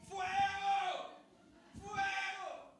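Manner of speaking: high-pitched cry of alarm. Acted. Preacher demonstrates crying out that the church is on fire, high yell. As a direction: call out for help as if the building burns. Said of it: "Eso es clamar".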